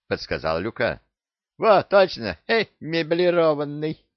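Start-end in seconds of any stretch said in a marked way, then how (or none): 1.6-4.1 cheeky parrot. Acted. Parrot mocks the pirate's name.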